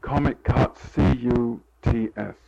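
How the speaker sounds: comic cuts said clearly, then C U T S spelled letter by letter; careful spelling out. Shown as a demonstration. Correcting the interviewer, he spells out the word slowly. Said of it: "K A T Z?".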